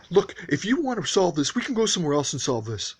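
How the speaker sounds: imitating the angry neighbor's threat; angry challenge. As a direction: square up and dare him outside. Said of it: "And then he got angry".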